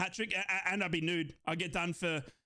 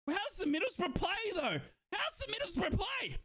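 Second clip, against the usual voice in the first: high, marvelling fan's cry; excited exclaiming; 9 semitones higher than usual. Real, not acted. Marvelling at the team.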